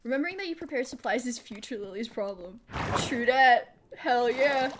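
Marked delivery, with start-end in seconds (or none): 3.1-4.7 louder enthusiastic agreement; hyped-up cheer. Real, not acted. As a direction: shout it out proudly. Cheering self on.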